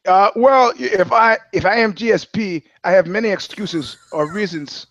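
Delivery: GSP as a hammed-up French Canadian; French Canadian accent. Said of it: "French Canadian accent".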